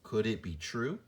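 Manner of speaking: "Could it be true?" with the pitch rising on true; curious rising question tune. Shown as a demonstration. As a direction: let true lift at the end. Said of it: "My voice sounds a certain way when I ask a question".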